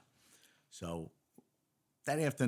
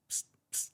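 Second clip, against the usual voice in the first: unvoiced hiss for attention; hushed psst. Cop signalling a SWAT officer at a barricade, hushed psst. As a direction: sneak a quick psst to someone nearby. Getting cop's attention again.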